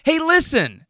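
high-pitched exasperated call into phone; mock yell, pitch jumping way up; imitated frustrated yell. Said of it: "when he yells".

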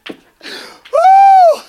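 high, loud whoops of praise; jubilant whoop. Real, not acted. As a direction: whoop with joy.

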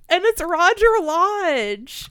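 loud, high, drawn-out announcement of a name; excited reveal. Real, not acted. Big reveal of boyfriend actor.

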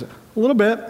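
high, strained imitation of fighting back tears; holding back tears. Traumatized captain answers the medic, imitated as trying not to cry. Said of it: "like he's trying not to cry".

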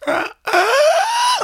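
very high, loud imitated wail; high-pitched cries, acted out; mock crying wail. Friend imitating the hit girlfriend's first cries, wailing.

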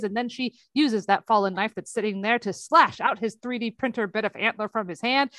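usual speaking voice

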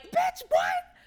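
high, loud scream of disbelief. Retold or imitated. Viewer reacting to the catfish confession, screaming. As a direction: scream it at the screen in shock. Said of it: "I was in this house screaming like".

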